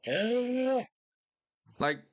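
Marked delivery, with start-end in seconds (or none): 0.1-0.8 imitated bear snap. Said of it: "and just go".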